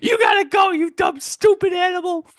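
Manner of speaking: hammed-up high-pitched scolding shout; mock shrieking threat. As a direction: shriek at the dumb animal. Voicing the girl yelling at snail.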